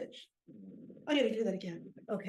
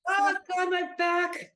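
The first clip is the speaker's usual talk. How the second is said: loud, high cry of pain; 7 semitones higher, 8 dB louder than usual. Acted. Old player's back gives out, pained cry. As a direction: cry out over a hurt back. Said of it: "My friggin' back".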